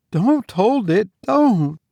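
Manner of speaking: high, urgent begging; scared wife pleading; frightened plea. A narrator's reading. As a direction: beg him anxiously, pitched well up. Wife begs husband to drop veil.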